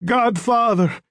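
high-pitched cry of a dying man; tortured, pleading call; desperate calling out. A narrator's reading. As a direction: call out blindly for help. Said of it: "he called out blindly".

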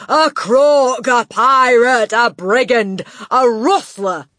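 loud, high scream. Acted. Headmistress screaming at the boy. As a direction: scream the accusation.

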